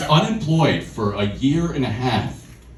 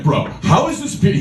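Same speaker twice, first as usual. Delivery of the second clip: fast, louder indignant bro; fired up; 8 semitones higher, 5 dB louder, faster than usual. Retold or imitated. The friend fired up at the pyramid charge. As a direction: defend the scheme, offended. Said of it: "He gets so fired up".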